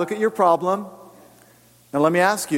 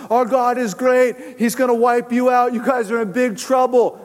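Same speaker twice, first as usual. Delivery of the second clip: higher-pitched mock war cry; imitated crowd shout; 6 semitones higher than usual. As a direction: call it out like a defiant crowd. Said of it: "shouted at the top of their lungs".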